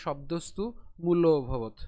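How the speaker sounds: শব্দস্তু joined to মূল ভবত in one breath; in one pull. Shown as a demonstration. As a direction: carry the অ into ও in one stroke. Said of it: "কেমন হবে তাহলে?". (একটানে উচ্চারণ)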